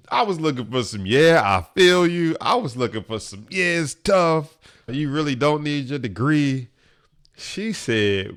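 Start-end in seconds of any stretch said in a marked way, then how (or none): 1.0-2.4 high, loud imitated sympathy; mock sympathy. The sympathy he wanted from his cousin, hammed-up agreement. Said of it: "I was looking for some sympathy". 3.5-6.7 raised-pitch, exaggerated commiseration; mock sympathy. Cousin agreeing he can skip the degree, mock sympathy. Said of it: "I was looking for some sympathy".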